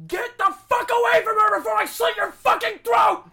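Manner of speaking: roaring threat at full volume; furious shout. Acted. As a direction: scream it in a rage, high and loud. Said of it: "I am going to shout".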